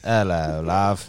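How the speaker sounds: put-on amused British-accented hello. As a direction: drop into a little mock-British voice. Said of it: "That's all I know how to say".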